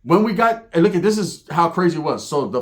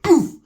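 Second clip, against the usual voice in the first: loud, high "oof"; sudden comic thump noise; imitated impact; 12 semitones higher, 7 dB louder than usual. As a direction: punch out the sound of a hit. Imitates the slap on his head.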